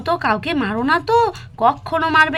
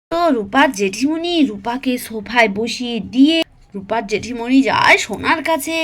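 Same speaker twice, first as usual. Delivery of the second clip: put-on children's story narration; 3 semitones lower than usual. A narrator's reading. Storyteller tells small children about the fight, sing-song narration. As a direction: draw out each phrase for young listeners.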